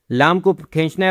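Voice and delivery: normal talking voice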